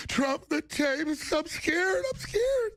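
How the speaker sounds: high, cracking fake-crying voice; cracky mock Michelle. Mocking Michelle Obama on Trump, high cracky fake cry. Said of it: "Michelle's all cracky voice".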